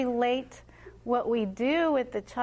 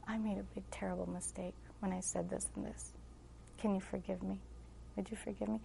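put-on soft, low-volume confession to a child; humble apology; 3 semitones lower, 13 dB quieter than usual. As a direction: quietly ask a child's forgiveness. Mother retells humbly asking forgiveness.

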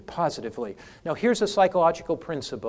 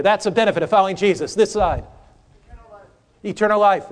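normal talking voice

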